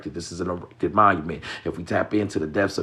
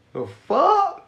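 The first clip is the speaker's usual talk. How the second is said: shocked; 6 dB louder than usual. Real, not acted. Reacting to being reported.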